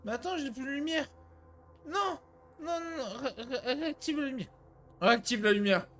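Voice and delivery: high frightened begging; panicked pleading. Real, not acted. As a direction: beg in a panic. Light goes out, panicking.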